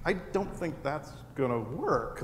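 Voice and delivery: soft, slow thought under the breath; inner thought, quiet. Retold or imitated. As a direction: think it quietly to yourself, slowly. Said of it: "in my head".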